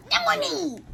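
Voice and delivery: very high loud shout of a name; big-guns yell for the cat; calling out. Real, not acted. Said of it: "Had to bring out my big guns for the cats".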